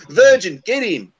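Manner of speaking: mock shout. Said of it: "two poofs look and go".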